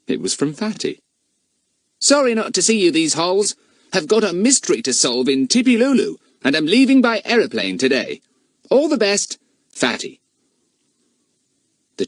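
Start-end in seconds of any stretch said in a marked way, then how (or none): 2.0-10.2 put-on boy reading aloud. A narrator's reading. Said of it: "He read it out loud".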